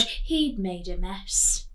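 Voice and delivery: lively read-aloud storytelling voice; story reading. A narrator's reading. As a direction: read it like a picture book to children. Teacher reading picture book aloud.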